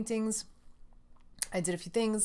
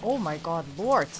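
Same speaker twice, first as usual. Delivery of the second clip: loud excited gamer; 2 semitones higher, 6 dB louder than usual. Real, not acted. Gamer cheering at a lucky run, loud and laughing. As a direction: whoop it up and laugh.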